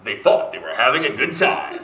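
imitating a trailer announcer; movie trailer voiceover. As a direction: do a hinted epic movie narrator. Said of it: "a movie trailer voiceover guy".

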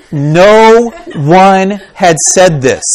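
booming, drawn-out emphasis; emphatic proclamation. Real, not acted. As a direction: hammer each word out slowly and loudly. Preacher driving home his point.

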